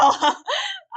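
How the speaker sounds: high, loud burst of laughter through tears; tearful laugh. Real, not acted. Admitting to viewers she cries, a loud teary laugh. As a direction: let a teary laugh break out. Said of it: "crying my ass off".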